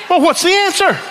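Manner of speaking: high, squeaky, exasperated plea; exasperated demand. Retold or imitated. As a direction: squeal it out, fed up. Frustrated businessman demands answer.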